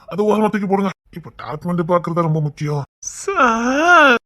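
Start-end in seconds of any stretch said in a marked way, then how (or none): 3.2-4.2 very long, high whimpered sir; hammed-up damsel whimper; drawn-out fake lady cry. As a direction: swoon with a fake sob.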